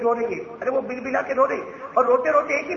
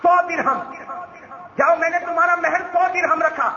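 high, raised proclamation; stern declaration; 8 semitones higher, 3 dB louder than usual. A narrator's reading. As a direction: declare it high and loud, upset. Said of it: "چہرے کا رنگ بدلا". (بلند آواز میں سخت اعلان)

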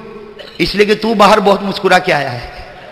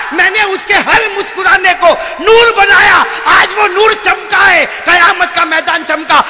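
raised, ringing sermon voice; preaching at full force; 7 semitones higher than usual. Acted. Preacher tells the crowd of God's reward, raised preaching. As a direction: proclaim it to the crowd.

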